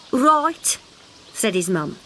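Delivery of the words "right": high mother voice, mildly scolding; scolding mother frog. Acted. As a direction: tell the child off lazily.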